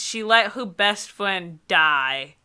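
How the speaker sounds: ordinary talk, nothing marked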